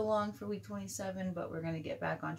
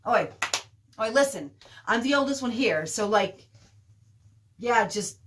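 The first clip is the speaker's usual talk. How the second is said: put-on louder, higher teenage assertion; loud bid to take charge; calling for attention; 5 semitones higher, 11 dB louder than usual. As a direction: call out over a group of kids.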